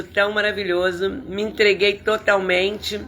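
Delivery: normal talking voice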